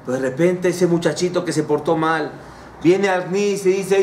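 ordinary talk

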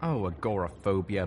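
usual speaking voice

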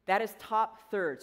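usual speaking voice

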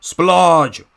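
Splodge called out high and loud in a Russian-tinged villain voice; summoning a henchman. Acted. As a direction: bark Splodge's name up the track.

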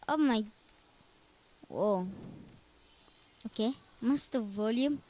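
ordinary delivery

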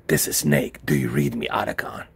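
low, gruff-free deep Snake voice; Solid Snake voice. Acted. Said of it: "I gotta do a voiceover".

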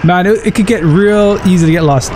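usual speaking voice

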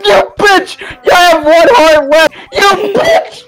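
ordinary talk

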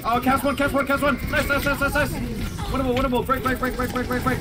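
high-pitched rapid hype callouts; excited callouts. Real, not acted. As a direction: cheer the team on mid-fight. Frantic callouts in a winning fight.

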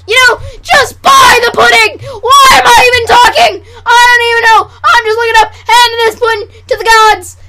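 high, loud mock-frantic rant; exasperated sales shouting. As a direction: lose the script and holler. Abandons script, yells at viewers.

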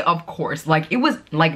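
usual speaking voice

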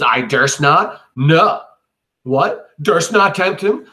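high, loud incredulous questions; indignant disbelief. Acted. Cassius outraged at Brutus's claim, loud disbelief. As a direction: spit the words back in outrage.

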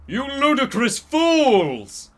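high, drawn-out angry shout; narrated shout. A narrator's reading. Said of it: "I shouted".

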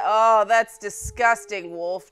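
loud, drawn-out revulsion; disgusted exclamation. Real, not acted. Player grossed out by her wolf, loud slow disgust. Reacting to wolf's gory eating.

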